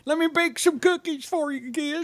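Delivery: put-on high, soft old lady voice; sweet old lady. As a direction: play a sweet little grandma. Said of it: "an old lady voice".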